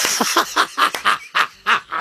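helpless laughter at a punchline. Real, not acted. Host cracks up at caller's dentist joke, laughing.

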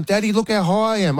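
put-on high, childlike voice calling to dad; dad mimics his daughter boasting on the wall, high and childlike; imitating little daughter. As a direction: play the small girl showing off her climb. Dad voicing daughter again.

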